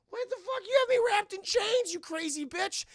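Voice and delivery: very high-pitched mock indignation; outraged elf. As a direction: squeal it like a furious little elf. Voices outraged chained elf Puck.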